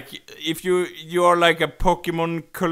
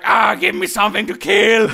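loud, raised crazed demand; crazed mock yell; 5 semitones higher, 8 dB louder than usual. Bored Pokemon going mad in its ball, crazed mock yell. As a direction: cry out like a stir-crazy creature. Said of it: "and be like".

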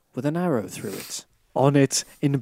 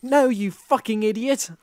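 higher, sharper shouted insult; angry shout; 7 semitones higher than usual. A narrator's reading. Goth girl yells at pleading Draco, angry. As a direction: yell it in his face. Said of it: "I shouted".

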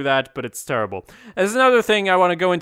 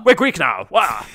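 hammed-up mock posh Greek; stuck-up, over-articulated; 3 dB louder than usual. Mocking the Olympus show's actors, stuck-up posh. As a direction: enunciate stiffly like a pompous actor. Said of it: "talking like they have an enormous stick".

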